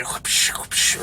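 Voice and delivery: breathy whooshing swish sounds; belt swish noise. Retold or imitated. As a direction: mimic a belt swishing through the air. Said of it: "whipped the dresser or the wall".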